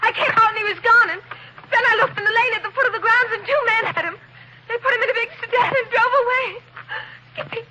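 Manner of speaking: frantic report. Acted. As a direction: pour it out in a panic. Reports the boy taken.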